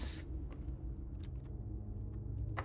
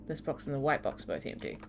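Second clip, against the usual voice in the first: softer, faster muttered reread; quiet fast reread; 5 semitones lower, 8 dB quieter, faster than usual. Shown as a demonstration. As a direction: read it under your breath quickly. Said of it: "The other two statements have identical wording".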